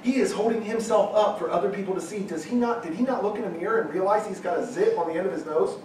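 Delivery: whisper-like gasp at the obvious; quietly scandalised, mocking the preachers; mock shock. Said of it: "and you're like".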